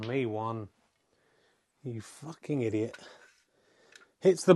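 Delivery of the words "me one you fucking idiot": low, soft, disgusted insult; disgusted mutter. Real, not acted. Player goes in off.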